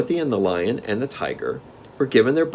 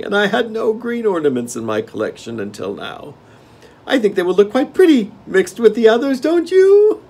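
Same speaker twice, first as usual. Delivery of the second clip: gnome king; 14 semitones higher, 9 dB louder than usual. Acted. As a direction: relish the game like a spoiled monarch.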